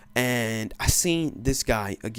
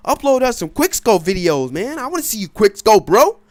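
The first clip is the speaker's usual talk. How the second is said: louder and higher eager fan voice; fans demanding; 7 semitones higher, 7 dB louder than usual. Pretend. Viewers begging the gamer for videos, eager and loud. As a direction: beg like an impatient fan. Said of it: "most of you guys are thinking".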